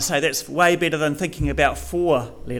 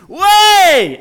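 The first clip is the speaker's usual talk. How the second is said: very loud, high, drawn-out exclamation; shocked disbelief; 12 semitones higher, 13 dB louder than usual. Shown as a demonstration. Reacting to news of a cat eating a dog, shocked disbelief. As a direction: cry out in astonished disbelief. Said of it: "an expression of shock or unbelief or surprise".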